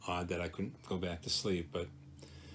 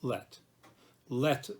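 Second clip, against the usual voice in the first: "let" said louder and higher, set apart by a pause; stress on let; 5 semitones higher, 4 dB louder than usual. Shown as a demonstration. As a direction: punch "let", then pause. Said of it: "pronounce more loudly, more, with more emphasis, the first word".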